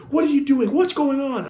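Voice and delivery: high, loud demand for answers; hammed-up high scolding; alarmed principal. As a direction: imitate a principal yelling questions. Principal confronting runaway, acted.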